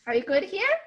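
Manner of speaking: usual speaking voice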